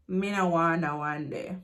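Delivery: low refusal in Jamaican Patois. Shown as a demonstration. Teacher giving learners the translation, in Jamaican Patois.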